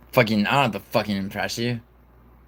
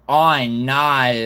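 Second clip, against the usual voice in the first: slow, drawn-out Australian "oh no"; louder, hammed-up Australian accent; 3 semitones higher, 5 dB louder than usual. Shown as a demonstration. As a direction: stretch it into broad Australian vowels. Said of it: "in an Australian accent".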